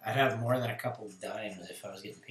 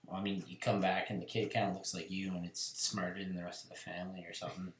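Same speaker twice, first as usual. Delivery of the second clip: ordinary talk, nothing marked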